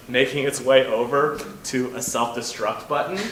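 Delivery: ordinary talk, nothing marked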